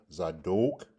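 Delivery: Zadok ending "doke"; O sound. Shown as a demonstration. Teacher points out the vowel, Zadok with an O sound. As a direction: bring out the long O in Zadok. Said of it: "you have a O sound".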